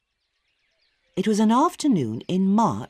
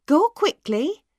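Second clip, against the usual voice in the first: hurried mother; 8 semitones higher, 5 dB louder than usual. A narrator's reading. Mother hurrying Maggie.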